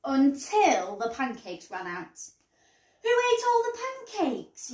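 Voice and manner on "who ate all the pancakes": yelling. A narrator's reading. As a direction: demand it like an outraged dad. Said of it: "yelled Dad".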